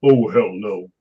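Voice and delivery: a hint of a deep movie-villain voice; Tony Todd deep voice. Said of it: "you know that deep voice he's got".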